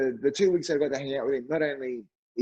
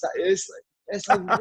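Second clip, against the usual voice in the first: ordinary talk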